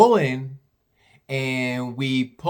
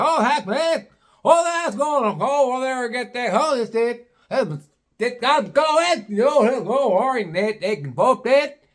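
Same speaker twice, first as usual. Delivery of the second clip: much higher slurred mechanic chatter; garbled hillbilly talk; 11 semitones higher than usual. Retold or imitated. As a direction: mumble between each other, unintelligible. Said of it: "this is how they are talking".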